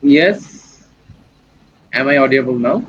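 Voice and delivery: normal talking voice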